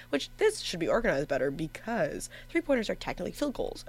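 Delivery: usual speaking voice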